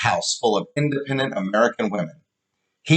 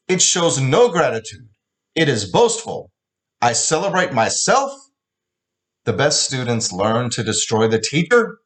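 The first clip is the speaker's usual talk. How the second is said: put-on higher, scornful accented rant; angry mocking; 4 semitones higher than usual. Father ranting at daughter over her speech, angry and mocking. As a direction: tear into the speech with contempt. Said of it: "He mocked my plagiarized words".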